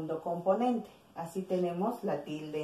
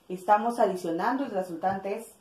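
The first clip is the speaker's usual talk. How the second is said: usual speaking voice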